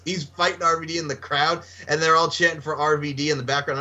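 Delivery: usual speaking voice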